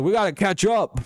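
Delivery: voiced with pitch jumping high.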